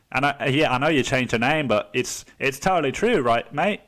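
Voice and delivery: put-on Aussie accent, insistent; Australian accent. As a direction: gush like an Australian fan calling out the author. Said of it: "if you say anything in an Australian accent".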